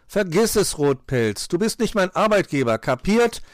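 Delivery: loud, high backtalk; defiant retort. A narrator's reading. Pechmarie tells fox he's no boss, defiant. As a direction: refuse brashly at full voice. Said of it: "entgegnete die Pechmarie".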